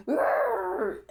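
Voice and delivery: put-on high, crying-tinged growl of a hurt child; mock growl. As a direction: growl like a wounded kid. Voicing an angry hurt child.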